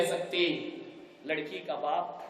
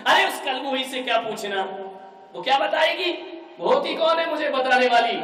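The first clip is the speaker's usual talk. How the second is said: loud angry outburst; 6 semitones higher, 6 dB louder than usual. Acted. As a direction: raise your voice, higher and louder, full of scorn. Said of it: "बेटा थोड़ा धीरे बोल".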